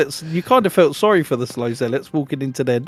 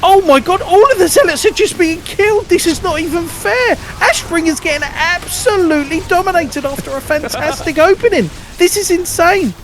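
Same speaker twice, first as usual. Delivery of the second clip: excited shouting; 12 semitones higher than usual. Real, not acted. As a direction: yell it as the fight collapses. Caster reacts to zealots dying.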